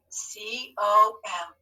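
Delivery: C, O, M drawn out slowly; spelling for writing. Shown as a demonstration. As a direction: dictate C... O... M, one letter at a time. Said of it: "tell your hand what to write".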